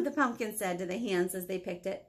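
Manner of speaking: ordinary delivery, nothing marked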